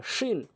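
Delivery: "শিন" with tongue middle against palate; long hushing sh in "শিন"; palatal "শিন". Shown as a demonstration. Teacher shows learners the tongue position, hushed "শিন". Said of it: "জিহ্বার মাঝখানে উপরে তালুর সঙ্গে".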